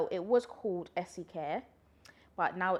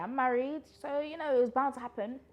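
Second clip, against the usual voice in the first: excited; 7 semitones higher, 5 dB louder than usual. Real, not acted. Excited justification.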